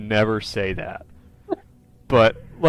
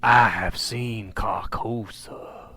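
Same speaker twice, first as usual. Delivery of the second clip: slow, drawn-out voiceless whisper; eerie whisper; 4 dB quieter, much slower than usual. Retold or imitated. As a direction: whisper it like an ominous secret. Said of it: "you hear him whispering".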